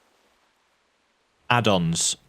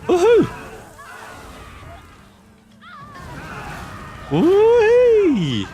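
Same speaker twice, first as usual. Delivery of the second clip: whooping high and loud with glee; thrilled woohoo at the top of the voice; excited high whoop; 4 dB louder, much slower than usual. Real, not acted. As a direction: let out a joyful yell. Riding the fire elemental.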